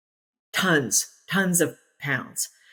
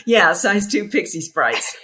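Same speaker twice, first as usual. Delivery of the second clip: laughing; 3 semitones higher, 5 dB louder, slower than usual. Real, not acted. Laughs through the phrase.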